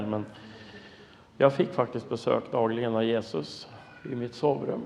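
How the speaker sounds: normal talking voice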